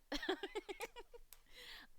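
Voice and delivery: sudden high-pitched laugh; real laugh at being told you have an accent; high bursting laugh. Real, not acted.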